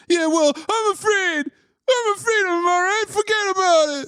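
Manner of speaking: high-pitched, hammed-up frightened whine; mock panic. Scared fighter answering his coach, high mock-panicked whine. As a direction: whine back at your teacher in a put-on panic.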